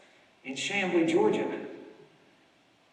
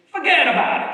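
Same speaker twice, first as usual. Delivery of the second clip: "Forget about it." punched loud and higher in a New York accent; 8 semitones higher, 9 dB louder than usual. Shown as a demonstration. Answering "what's your story" New York style, in a loud New York accent. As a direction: toss off "Forget about it." like a born New Yorker. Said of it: "a common response to".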